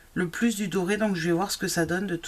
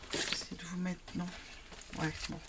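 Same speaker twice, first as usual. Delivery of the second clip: muttered aside; 12 dB quieter, much faster than usual. Real, not acted. Muttering to herself off-mic.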